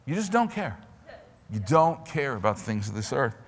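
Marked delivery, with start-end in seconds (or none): 0.0-0.8 loud, high preacher's cry, racing ahead; preaching. Acted. Preacher urging the congregation to stand, loud and fast. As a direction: drive the scripture home hard. Voicing a believer's prayer, preaching.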